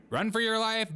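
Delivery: mock alarm. Mock warning to the bears.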